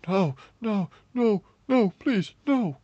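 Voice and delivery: high, panicked repeated denial; distraught pleading. A narrator's reading. Man holds wounded girl, pleading high and distraught. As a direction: plead as someone slips away in your arms. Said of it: "All I could hear was his muffled voice".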